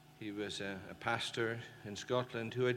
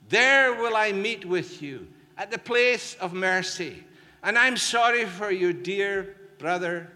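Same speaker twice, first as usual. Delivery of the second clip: full-force preaching; 8 semitones higher, 11 dB louder than usual. Acted. Preacher voicing God's invitation.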